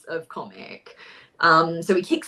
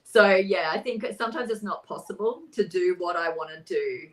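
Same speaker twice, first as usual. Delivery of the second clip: ordinary talk, nothing marked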